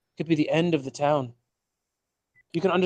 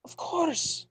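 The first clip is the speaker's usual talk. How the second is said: high priestly voice; 13 semitones higher, 4 dB quieter than usual. Acted. Priest answers, voice shifts high.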